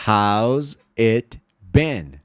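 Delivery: slow, separated words with a clear T in "it"; careful full "it" before "been"; T pronounced in IT. Shown as a demonstration. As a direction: articulate the T of "it", very slowly. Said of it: "a gente falou it com o T".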